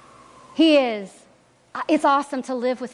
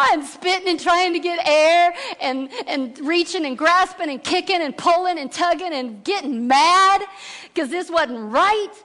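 higher, louder breathless telling; frantic retelling; 7 semitones higher, 3 dB louder than usual. Retold or imitated. Telling of sinking with no thumbs, frantic and higher. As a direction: rush it like you're struggling for air.

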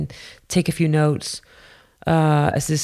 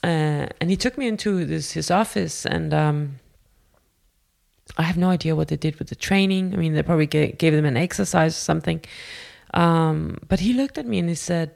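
plain reading voice

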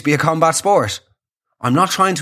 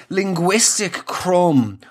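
ordinary talk, nothing marked

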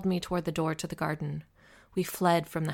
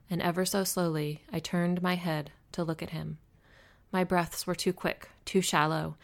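ordinary delivery, nothing marked

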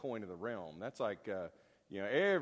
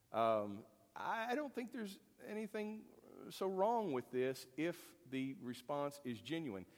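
ordinary talk

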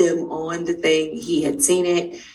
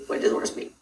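whispered; 6 dB quieter than usual. Retold or imitated. Repeats question, voice dropping.

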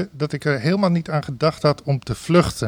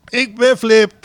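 ringmaster call; 6 semitones higher, 4 dB louder, slower than usual. Retold or imitated. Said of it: "ringmaster call".

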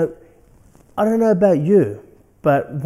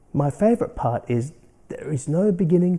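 normal talking voice

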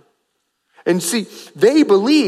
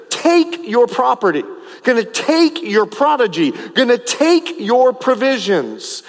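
each "take" punched; repeated stress on take; 3 semitones higher than usual. Real, not acted. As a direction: hammer "take" at the head of each phrase. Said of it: "I emphasize the word over and over".

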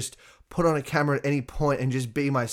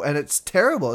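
ordinary talk

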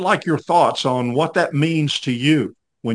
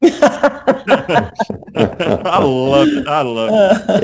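laughing; 9 semitones higher, 5 dB louder, much slower than usual. Real, not acted. Delighted recalling the grandmother.

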